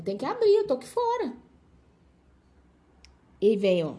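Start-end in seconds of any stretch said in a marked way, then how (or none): 0.0-1.3 high-pitched plea from outside; impatient calling out. Acted. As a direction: call out as if locked out. Acts person locked out in rain.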